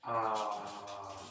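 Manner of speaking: low, soft breath cues with long "ah"; guided breathing meditation. Shown as a demonstration. Yoga teacher guiding breaths and saying "ah", low and soft. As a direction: lead the breath softly and low, sighing out a long "ah".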